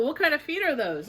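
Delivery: plain reading voice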